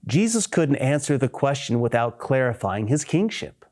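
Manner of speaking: usual speaking voice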